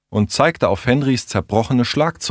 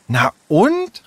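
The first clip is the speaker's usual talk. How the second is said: high-pitched, indignant retort; worked up; 10 semitones higher than usual. Acted. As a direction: throw it back, exasperated. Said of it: "regte Tessa sich auf".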